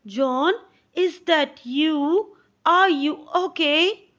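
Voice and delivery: a hint of a mother checking on her son; concerned mother. Mother calling through the door to John, lightly voiced worried mother.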